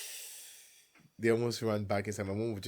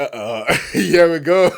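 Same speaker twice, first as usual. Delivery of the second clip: slow, laughing and loud; 7 semitones higher, 17 dB louder, slower than usual. Retold or imitated. Caught by dad, laughing it out. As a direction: giggle through the dread. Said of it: "I was like".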